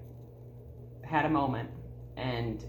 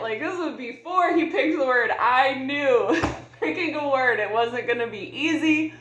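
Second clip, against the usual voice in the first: laughing; 9 semitones higher, 5 dB louder than usual. Real, not acted. Laughing about her own reluctance.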